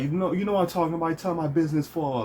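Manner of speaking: high, quick mimicked accusation; Yuki's soft-spoken arguing. Former friend accusing the speaker of telling, high quick arguing. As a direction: argue fast in a high imitated voice. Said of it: "that's how he was saying it".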